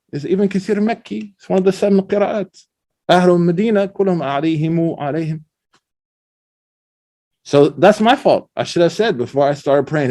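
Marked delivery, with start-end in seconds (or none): none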